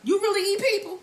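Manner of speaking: high, incredulous Tommy voice; imitating Tommy. Recapper voicing Tommy asking Milan, high and incredulous. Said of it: "And Tommy says".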